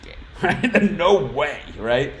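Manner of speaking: high laughing "no way"; laughing disbelief. Real, not acted. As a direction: laugh it off as if it's obvious. Laughing at game not being cancelled.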